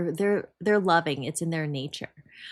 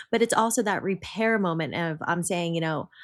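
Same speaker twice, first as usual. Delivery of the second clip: ordinary delivery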